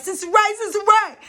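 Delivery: high, loud frantic calling of a name; man banging on the bathroom door, yelling. Retold or imitated. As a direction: call through the door in a panic. Trying to bust the door down.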